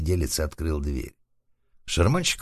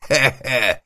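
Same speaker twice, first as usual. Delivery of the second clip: short, loud acted chuckle; mocking chuckle; 8 semitones higher, 6 dB louder than usual. A narrator's reading. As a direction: chuckle twice, high and forced. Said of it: "засмеялся шарманщик". (громкий смешок)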